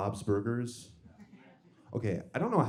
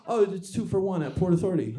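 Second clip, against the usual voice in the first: proud shopper; 7 semitones higher, 4 dB louder than usual. Pretend. Acted store-ad dialogue.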